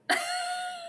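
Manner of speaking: very high, tiny giggle of disbelief; real high giggle at her own mistake; squeaky disbelieving laugh. Real, not acted. Frozen on live stream.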